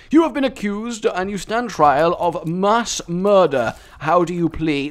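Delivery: lightly voiced stern judge; a hint of a formal courtroom judge.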